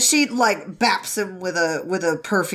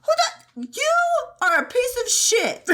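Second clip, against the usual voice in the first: high mock girl voice. Acting out Mian Mian's retort.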